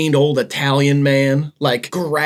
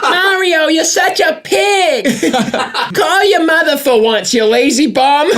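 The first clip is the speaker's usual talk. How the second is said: much higher, loud scolding Italian mother; loud Italian woman; 10 semitones higher than usual. Pretend. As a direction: yell at Mario like an angry Italian mom. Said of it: "loud Italian women".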